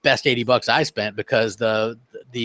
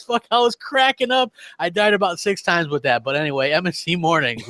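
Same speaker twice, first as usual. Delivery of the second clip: ordinary talk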